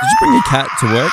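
very high, fast outraged yell; shocked shout. Acted. Coworker shocked at a cat in office, high shout. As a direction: shriek it in disbelief.

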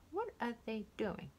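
plain reading voice

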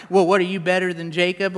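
quick, snarky challenge; mock-snarky comeback, rattled off. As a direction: toss it out fast with sassy attitude. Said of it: "read it with a snarky tone of voice".

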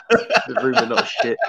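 high, laughing repeat. Real, not acted. Host repeating the friend's joke tagline, high and laughing.

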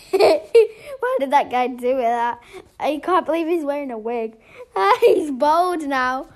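loud laughter through the words; laughing. Pretend. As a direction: crack up at the funny movie. Laughing at funny movie.

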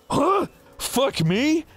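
high, incredulous mock outburst; retold outrage, high-pitched but not shouted; mock outraged bartender. Retold bartender's angry threat.

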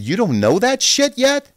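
high, loud incredulous jab; mock outrage at a linebacker coach; incredulous scolding. Berating coach over tendencies.

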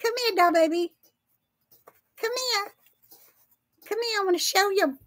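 cat voice. Real, not acted. Calling her cat over in a very high sing-song voice. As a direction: coo up high like calling a kitten. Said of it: "There's that cat voice".

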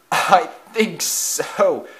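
slow, high, strained reply; choked. A narrator's reading. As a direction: choke it out after a crash. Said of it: "choked ninety-four six two".

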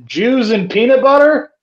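high-pitched incredulous question; Bill Murray. Retold or imitated. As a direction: ask it in mock disbelief. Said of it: "Bill Murray says".

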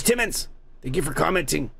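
ordinary delivery

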